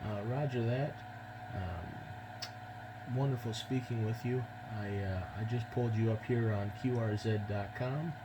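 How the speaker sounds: ordinary talk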